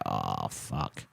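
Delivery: very low, hushed groan of resignation; sinking dread. Retold or imitated. Comic arriving to find shouting kids outside, sinking dread. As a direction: let it drop right down, deflated and under the breath.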